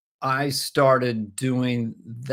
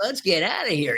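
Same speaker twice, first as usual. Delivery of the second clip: Shaggy voice; 6 semitones higher than usual. Acted. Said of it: "Scooby-Doo and Shaggy".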